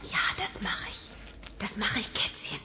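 soft, secretive whisper. Acted. Bibi whispers to the kitten, secret whisper. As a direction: whisper it so only the kitten hears. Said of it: "flüstert ihm etwas ins Ohr".

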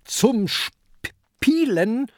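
slow, high rising Zum Spielen?; indignant disbelief. A narrator's reading. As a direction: throw the words back in disbelief. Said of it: "ruft Peter".

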